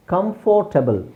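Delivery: ಕಂಫರ್ಟಬಲ್ in full as kam-FOR-ta-bal; wrong pronunciation. Shown as a demonstration. Teacher quoting a common mistake, wrong pronunciation. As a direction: sound out the "for" in ಕಂಫರ್ಟಬಲ್. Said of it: "that's not correct pronunciation".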